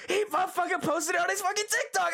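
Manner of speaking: fast, high-pitched outraged rant; outraged shout. Real, not acted. Telling viewers the friend posted the clips himself, outraged shout. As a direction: shout the betrayal in a high rush.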